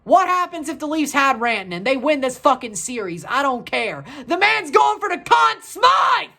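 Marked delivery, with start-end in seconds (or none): 4.3-6.3 loud high shout in praise; excited shout. Real, not acted. Fan shouting praise for Rantanen, loud and high. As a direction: yell it out for the star.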